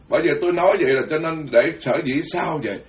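normal talking voice

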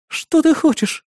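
very high, fearful question; timid. A narrator's reading. Captive woman asking the gunman's demands, timid. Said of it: "боязливо спросила Мила".

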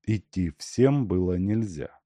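ordinary delivery

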